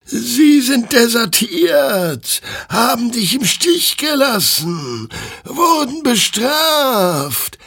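very high, drawn-out wail; slow, eerie high-pitched declaration; chorus of burning souls. A narrator's reading. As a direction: intone it like tormented spirits. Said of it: "erklärten die flammenden Seelen ihm im Chor".

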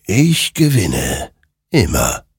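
slow, weighty ghostly voice; sinister spectre. Acted. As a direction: draw out each word with finality. Spectre claims victory in every war. (langsam und unheilvoll)

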